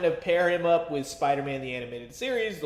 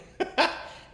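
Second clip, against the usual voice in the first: brief amused laugh; short chuckle. Real, not acted. Reviewer amused by his gambit harness description, short chuckle. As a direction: let a quick chuckle slip out.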